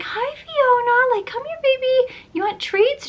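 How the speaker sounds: put-on very high coaxing baby-talk; good voice. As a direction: coax a runaway dog in a high, sweet voice. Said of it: "in a good voice".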